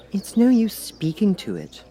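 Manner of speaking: put-on a young girl thinking aloud; Alice voice. Alice waits for the Cat's ears, girlish musing.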